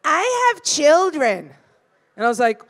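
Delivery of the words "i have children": put-on drawn-out, haughty I have children; slow entitled mom voice. Woman in the express line answering the comic, slow and haughty. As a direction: stretch it out like an entitled mother. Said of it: "she's like".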